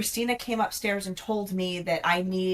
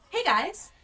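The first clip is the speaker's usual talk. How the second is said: light, higher, friendly greeting; chirpy email voice, pitched up; typing-an-email voice; 9 semitones higher than usual. Shown as a demonstration. Veteran demonstrating the email voice to co-hosts, light and higher. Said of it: "this is the voice that I use".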